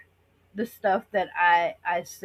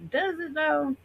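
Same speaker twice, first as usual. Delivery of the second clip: skeptical retort; 7 semitones higher, 3 dB quieter than usual. Retold or imitated. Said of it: "and it's like".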